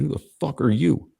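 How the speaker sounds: high, combative retort; retold angry challenge. Caller to frat guy holding his friend, retold angry challenge. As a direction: throw the challenge back, re-enacted.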